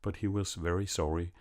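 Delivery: ordinary delivery, nothing marked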